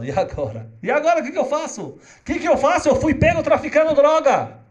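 frantic cry of a man just arrested; panicked smuggler. Retold or imitated. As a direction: pitch shoots up high and loud in alarm.

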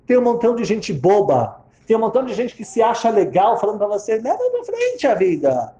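mock the foolish advice givers; scornful. Old woman on people giving empty advice, scornful.

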